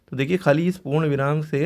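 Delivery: ordinary talk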